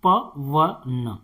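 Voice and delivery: प व न each syllable said apart, very slowly; spelling out syllables. Shown as a demonstration. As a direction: sound out प, व, न one by one. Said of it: "इसके बाद है जैसे पवन". (प व न को अलग-अलग धीरे बोलना)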